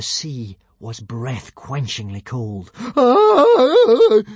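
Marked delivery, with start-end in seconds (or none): 3.0-4.2 high, loud shriek of fear; terrified shriek. Acted. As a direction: shriek in the cold water. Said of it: "shrieked Fishlegs".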